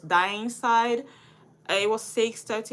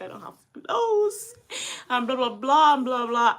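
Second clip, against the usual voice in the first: teary whine; 4 semitones higher, 5 dB louder than usual. Retold or imitated. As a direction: whimper it like a crying girl. Retelling her crying complaint to dad.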